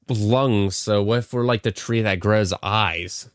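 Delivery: ordinary talk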